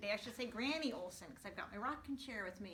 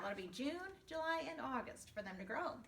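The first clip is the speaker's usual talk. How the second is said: usual speaking voice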